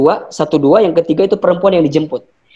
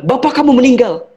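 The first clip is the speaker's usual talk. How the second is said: high urgent outburst; 8 semitones higher than usual. Retold or imitated. Telling the son his father died, high urgent cry. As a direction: blurt the death news at him. Breaking news of father's death.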